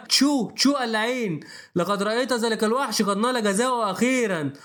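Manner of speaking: ordinary delivery, nothing marked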